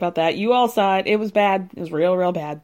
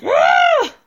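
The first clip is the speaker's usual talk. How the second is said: high, loud imitated scream; 6 dB louder than usual. Imitating an enraged cast member's scream. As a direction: let out a sudden high-pitched scream. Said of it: "you can hear her scream".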